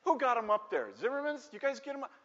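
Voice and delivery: high-pitched scornful dad act; mocking dad. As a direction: play a father joining in the ridicule. Imagined dad sides with bullies.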